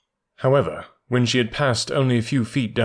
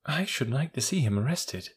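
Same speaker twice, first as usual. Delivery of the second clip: higher, softer voice of an eager curious woman; morbid curiosity; 4 semitones higher, 7 dB quieter than usual. A narrator's reading. Woman wishing to see the thief arrested, softly curious. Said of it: "with that morbid curiosity".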